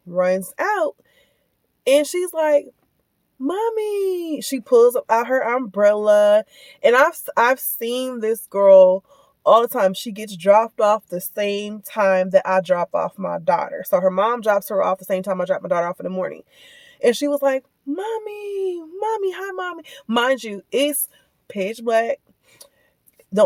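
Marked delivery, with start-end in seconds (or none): 3.4-4.4 put-on high little-girl voice; high mock child's call; child calling mommy. Child calling for her mom. 17.9-19.8 put-on high, soft little-girl call; high soft child voice; child calling mommy. Child calling in dark lot.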